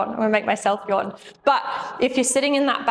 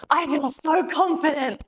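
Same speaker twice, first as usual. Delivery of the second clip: imitating the patient; 5 semitones higher than usual. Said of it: "he goes".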